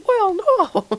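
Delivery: mock squeak, leaping very high; mimicked voice crack. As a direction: imitate a cracking voice, drawn out.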